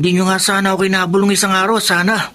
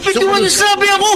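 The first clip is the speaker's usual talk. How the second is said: desperate shout; 13 semitones higher than usual. Acted. Caught thief shouting at the bike owner to let go. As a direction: yell to break free.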